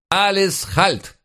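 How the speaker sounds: high, drawn-out German officer's order; officer's command. A narrator's reading. Escorting German officer halts the prisoners, high commanding call. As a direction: call a halt in a high, stretched voice. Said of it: "раздался голос сопровождающего нас офицера".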